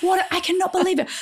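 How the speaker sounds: high, hushed, exasperated scolding; mock furious husband in a whisper; angry husband. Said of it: "like, angry at me".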